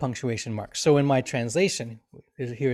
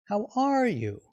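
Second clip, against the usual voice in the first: high-pitched "are", falling away after it; inquiring tune peaking on "are"; wondering question; 8 semitones higher than usual. Shown as a demonstration. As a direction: ask with "are" lifted high. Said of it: "We are wondering something".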